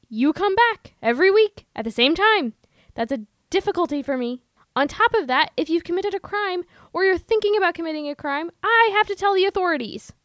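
loud, heated therapist scolding; dramatic reading. Acted. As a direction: push back hard, raising the voice. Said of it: "Do you want to do a dramatic reading?".

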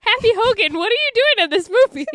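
high, loud incredulous greeting; mock surprise. Host spotting Happy Hogan in wrong movie, mock surprise. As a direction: call out in playful disbelief. Teasing character for turning up.

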